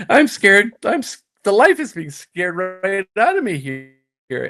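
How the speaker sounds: high-pitched, slow mock panic; retold fright. Young player called over by a golf tough, acted fright. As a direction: play up being scared, pitched high. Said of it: "I'm nervous as hell".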